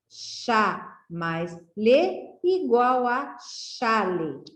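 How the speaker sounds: "Chá mais lê" said slowly, syllables apart, then "chale" blended; syllables joined slowly. Shown as a demonstration. Teacher blending syllables into a word for children, slow. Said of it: "para você formar a palavra chale".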